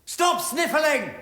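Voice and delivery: slow, furious high shout; angry shout. Acted. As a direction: snap the order out loud. Angry outburst in drama.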